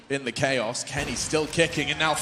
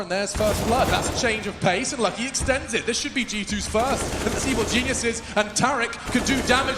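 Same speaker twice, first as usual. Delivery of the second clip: higher, quicker, building hype; building excitement; 8 semitones higher than usual. Real, not acted. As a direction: ramp up as the fight starts. Round opens, caster building.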